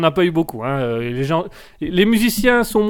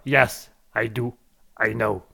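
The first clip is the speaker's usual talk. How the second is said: put-on English accent; 8 semitones lower, 6 dB quieter, slower than usual. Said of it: "à chaque fois que tu fais un accent anglais".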